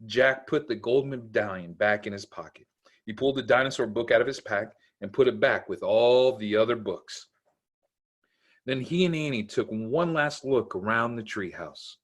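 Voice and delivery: ordinary delivery, nothing marked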